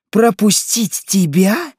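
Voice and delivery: loud, high, slow incredulous question; outraged retort. A narrator's reading. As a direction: throw the request back in scorn. Mother refuses to let queen pass. (возмущённый громкий вопрос)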